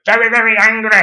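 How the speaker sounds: high, mimicking comic outrage; mock angry. Recalling two Northerners losing it, mock anger. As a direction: send up their anger, pitch climbing through the words. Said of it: "two Northerners just getting very, very".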